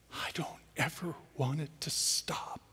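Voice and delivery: soft breathy whisper; whispered longing. Real, not acted. Preacher confessing he never wants the embrace to end, whispered. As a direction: whisper it like a secret wish.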